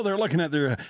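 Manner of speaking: ordinary talk, nothing marked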